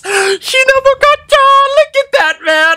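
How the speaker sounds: very high, excited squeal; real fangirl squeal. Real, not acted. Showing viewers the Shinobu Kocho figure, excited squeal. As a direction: squeal with delight at the reveal.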